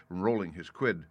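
ordinary delivery

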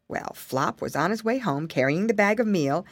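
ordinary delivery

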